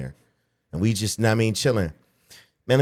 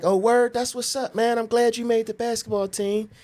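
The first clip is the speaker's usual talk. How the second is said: high-pitched imitation of Dad; high, lightly acted congratulations; dad congratulating. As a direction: pretend to be pleased for your kid.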